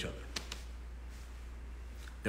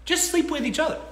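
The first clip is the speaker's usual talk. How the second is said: high exasperated; 12 semitones higher than usual. Real, not acted. Exasperated advice to men.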